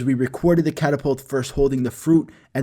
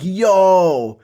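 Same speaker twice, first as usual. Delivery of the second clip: long drawn-out, loud "yo"; a whole class hyped at once, louder and higher; hollered in amazement, stretched out; excited crowd reaction; 4 semitones higher, 5 dB louder than usual. Retold or imitated. Whole class reacts to big news.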